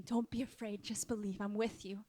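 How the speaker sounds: hushed, calming voice; Jesus reassuring. Acted. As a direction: drop right down to comfort them. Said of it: "And Jesus is saying".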